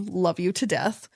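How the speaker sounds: normal talking voice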